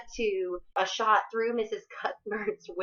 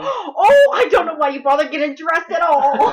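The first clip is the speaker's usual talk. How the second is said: much higher and loud put-on voice; mock scandalised; 9 semitones higher, 12 dB louder than usual. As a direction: clutch your pearls. Mocking a roommate's tight dress.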